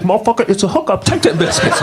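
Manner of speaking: high-pitched mock scolding; pushy girl scolding. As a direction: order him about in a high girl's voice. Acts LaWanda scolding him.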